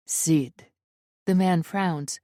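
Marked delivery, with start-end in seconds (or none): 0.1-0.7 low, puzzled Russian intruder; Russian accent. A narrator's reading. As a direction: repeat it deep, with a Russian accent. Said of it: "The Russian accent".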